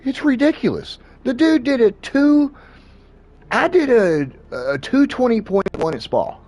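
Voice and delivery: way up high, worked-up and amazed; high, slowed, amazed rant; excited. Real, not acted. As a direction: pitch high with disbelief at the lap time. Excited retelling of lap times.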